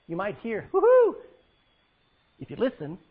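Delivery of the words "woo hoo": very high, very loud joyful whoop. Pretend. Preacher imagining the rapture call, a joyful whoop.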